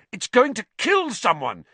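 high, loud panicked cry; alarmed shout. A narrator's reading. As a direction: yell it in alarm. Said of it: "he shouted".

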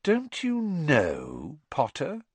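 slow, high, drawn-out needling; breathed taunt. A narrator's reading. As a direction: stretch out the question to goad him. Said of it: "breathed Malfoy".

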